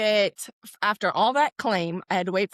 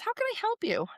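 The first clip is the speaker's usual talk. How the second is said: high, softer customer-service voice; chipper service voice; 13 semitones higher, 6 dB quieter, faster than usual. Retold or imitated. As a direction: sing it like a cheerful agent. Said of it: "Juan says".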